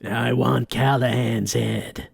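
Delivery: slow, low boss voice; menacing mob boss. Acted. Mob boss ordering a hit, low and menacing.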